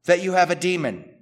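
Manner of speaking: loud, high mock accusation; accusing shout. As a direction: hurl the accusation at him. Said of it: "they say".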